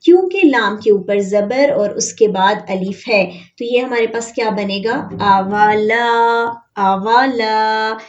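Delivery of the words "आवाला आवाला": आवाला slowly, long ला; drawn-out madd. Shown as a demonstration. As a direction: hold the ला in आवाला. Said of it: "इसको आवाला नहीं पढ़ना". (आवाला धीरे, ला लंबा)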